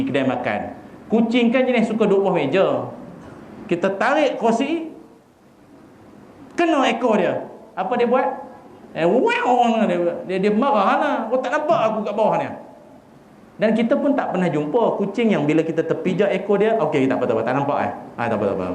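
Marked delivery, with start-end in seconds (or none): 3.7-12.5 a hint of an offended cat, pitched up and quick; lightly voiced angry cat. Cat scolds the diner who stepped on its tail, mildly huffy. 16.8-18.7 mock forgiving cat.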